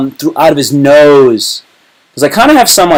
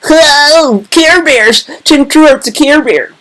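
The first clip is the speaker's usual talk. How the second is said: excited; 14 semitones higher, much slower than usual. Real, not acted. Excited at the drawing.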